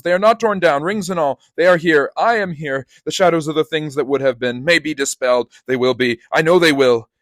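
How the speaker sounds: high, loud overjoyed declaring; cling to hope and cry it out; joyful cry. Acted. Scrooge to the room, joyful high cry. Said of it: "cried Scrooge".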